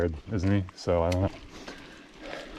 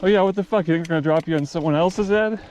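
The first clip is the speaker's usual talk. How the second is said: much higher, louder sarcastic retort; exasperated Billy Bob; 9 semitones higher, 3 dB louder than usual. Retold or imitated. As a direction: scoff at the silly question. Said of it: "he's like".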